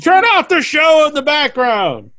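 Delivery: high, exasperated shout; playful yelling. Real, not acted. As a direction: holler it across at a hapless guest. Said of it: "I don't wanna yell at you".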